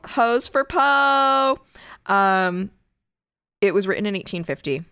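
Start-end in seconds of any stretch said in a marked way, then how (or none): 0.1-1.6 slow, high, loud ghostly echo; spooky ghost voice. Pretend. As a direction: croon it like a cartoon ghost. Echoes the spooky Poe pun.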